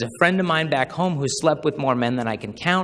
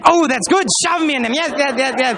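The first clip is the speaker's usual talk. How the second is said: high squealing mock schoolgirl, louder; squealing girl; 9 semitones higher, 4 dB louder than usual. Schoolgirl thrilled to be shoved into her crush, high squeal.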